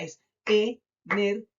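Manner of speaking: "energía" slowly as e, ner, GÍ, a; stress on I. Shown as a demonstration. Teacher giving the answer, "energía" in beats with stress on GÍ. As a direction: separate the syllables, long GÍ in "energía". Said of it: "Se carga en la palabra en la I".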